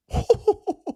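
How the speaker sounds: high-pitched burst of laughter. Real, not acted. Streamer reacting to own quiz answer, high laugh. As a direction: laugh up high in quick pulses.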